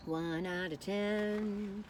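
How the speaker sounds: very slow, stretched rating; drawn out. Real, not acted. Rating her snack, said very slowly. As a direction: drag each word out slowly.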